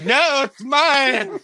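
high, loud, drawn-out refusal; hammed-up possessive yell; mock yell. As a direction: imitate a stubborn holler, dragging it out. Retold refusal escalates in tug-of-war.

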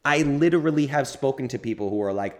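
ordinary talk

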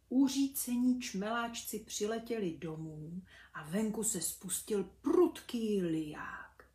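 ordinary delivery

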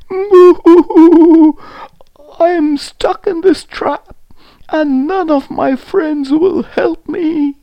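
loud, high mock wailing; hammed-up high crying; crying lion. A narrator's reading. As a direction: wail and boo-hoo as a trapped lion. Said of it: "the lion began to cry".

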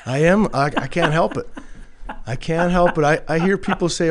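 loud, high laughing reply. Real, not acted. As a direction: laugh through the words. Laughing reply to cowboy remark.

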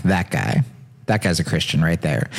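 low, soft awed pointing; mock reverent low voice. Friend mocking awe at presidents, low hushed voice. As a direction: murmur it like spotting a saint. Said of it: "been like".

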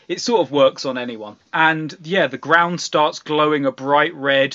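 normal talking voice

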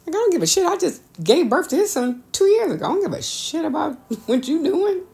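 high, dismissive and a bit laughing. Retold or imitated. To the ex's pregnant girlfriend, high dismissive retort. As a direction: brush her off completely. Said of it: "retold reaction to ex's girlfriend".

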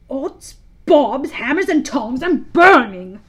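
loud oath rising from a high start; startled yell. A narrator's reading. Hook jumping off the hot mushroom, yelling in alarm. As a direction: explode on the final word.

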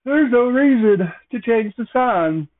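exaggerated high mock protest; mock objectors. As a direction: imitate people protesting, pitched well up. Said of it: "I know some people will be like".

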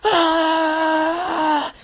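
long high-pitched mock scream; sustained mom-rage shriek, hammed up; imitated scream. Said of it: "she just starts screaming".

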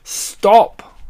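loud, high shout; sudden pleading outburst; anguished cry. A narrator's reading. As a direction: cut him off with a cry. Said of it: "cried Badger".

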